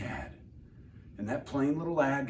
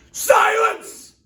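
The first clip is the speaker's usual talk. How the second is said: very high, loud furious shout; barked; 13 dB louder than usual. Acted. The king barking Mac into silence. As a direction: bark the order down at him. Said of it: "barked back".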